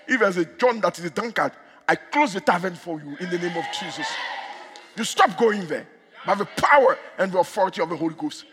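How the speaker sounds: ordinary delivery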